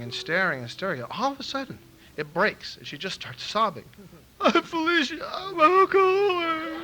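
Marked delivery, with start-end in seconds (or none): 3.9-4.6 high wailing sob burst; sobbing wail. Retold or imitated. Mimicking her breakdown. 4.6-6.7 high, loud sobbing through the words; mock sobbing. Imitating Felicia sobbing at him, high wailing sob. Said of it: "Like that".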